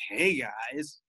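soft, breathy come-on greeting; porn ad voice.

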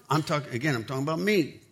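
ordinary talk

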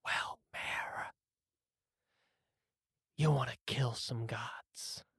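conspiratorial hushed rasp; raspy whisper. Acted. Golem inviting the player to kill gods, close raspy whisper. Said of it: "looks really close to your face".

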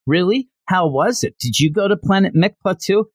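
lightly voiced excited schoolgirl; eager girl. Aries voiced, excited questions.

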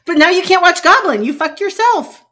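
high, loud gloating yell; loud triumphant taunt at her friend; gleeful shout. Real, not acted. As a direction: crow over her mistake.

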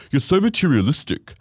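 lightly mocking his line; a hint of the guy, sneering; mock guy quote. Guy accusing his date, mock sneer. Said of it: "he was like".

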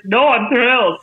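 retold burst of delight; thrilled. Actor insisting to worried colleagues, thrilled. Retelling her delighted reply to everyone.

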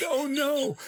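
imitating friend. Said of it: "He said".

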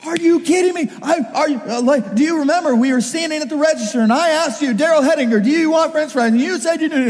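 high, indignant rant at dad; worked-up cross-examination, voice pitched high; going off. Retold or imitated. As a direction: pile up the accusations in outraged disbelief. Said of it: "I just went off".

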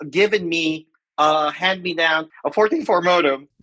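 ordinary delivery

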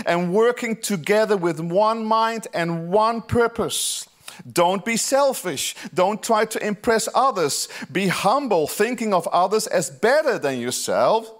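high, slow, pressed preaching read; fervent scripture reading. Acted. Pastor reading Philippians to the church, fervent and slow. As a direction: read Paul's plea as a passionate appeal.

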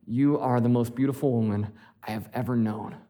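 a hint of a nervous, tender proposal. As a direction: say it softly like you mean it. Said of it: "and I was like".

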